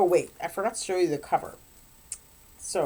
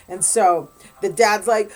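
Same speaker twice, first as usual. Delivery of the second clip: ordinary talk, nothing marked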